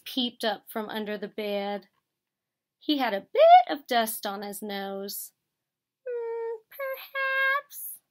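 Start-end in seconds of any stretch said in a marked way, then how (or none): 6.1-8.0 high, coy little squeak; playful mouse. A narrator's reading. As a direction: tease it sweetly. Mouse teasing Bear.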